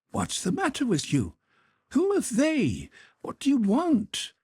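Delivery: puzzled, a little alarmed; bewildered mother. Acted. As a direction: Countess confronting her daughter, baffled. The Countess answering.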